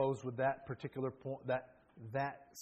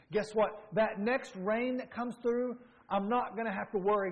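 louder, higher preacher's cry; full-force preaching; 7 semitones higher, 6 dB louder than usual. Acted. Preacher rallying the congregation, in a loud, higher preaching cry. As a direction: preach it out, voice lifted high.

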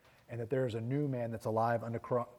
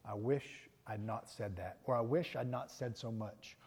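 regretful thought; 3 semitones lower, 8 dB quieter than usual. Retold or imitated. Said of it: "walked away and thought".

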